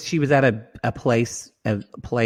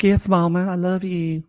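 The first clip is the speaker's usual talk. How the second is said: mock mama's boy; 8 semitones higher than usual. Son sucking up to his mother, mock adoring. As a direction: imitate a devoted little boy, pitched way up. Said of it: "I was always like".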